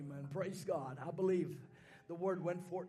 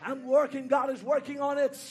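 loud, high shouted preaching; fiery pentecostal preacher at full force; 8 semitones higher, 11 dB louder than usual. Acted. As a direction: preach it out at the top of your voice.

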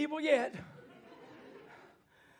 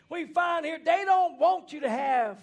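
preaching burst; 5 semitones higher, 10 dB louder, slower than usual. Acted.

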